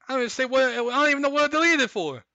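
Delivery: exasperated rant. Real, not acted. Ranting about deleted post.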